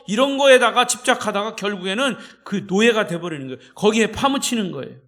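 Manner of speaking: loud, high, fervent pulpit preaching; preaching at full force. Acted. Pastor warns the congregation about worldly attachment, preaching loud and fervent. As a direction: hammer each idol home to the congregation.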